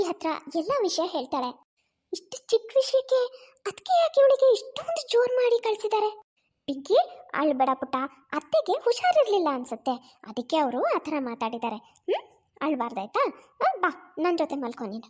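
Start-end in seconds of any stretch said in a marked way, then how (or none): 2.1-6.2 higher, hushed and troubled thinking aloud; hurt wondering. Acted. Mother wonders at the scolding. 6.7-15.1 put-on low, soothing voice to a crying child; comforting mother.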